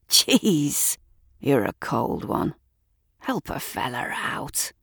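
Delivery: a gruff local man, half whispered; rough bloke voice. Acted. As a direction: lean in, wheedling for a smoke. Man cadges a cigarette.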